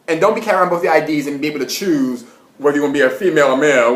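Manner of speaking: ordinary delivery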